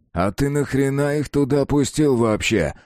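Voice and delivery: high, slow indignant challenge. A narrator's reading. Маркин asks why children were let in, indignant. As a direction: demand an explanation, dragging it out in outrage. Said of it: "возмутился Маркин".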